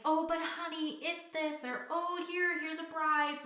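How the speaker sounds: mock wheedling with a bribe; mock pleading parent. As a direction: play the parent begging their child to stop. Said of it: "not saying".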